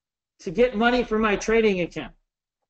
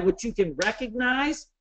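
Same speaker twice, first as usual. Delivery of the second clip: normal talking voice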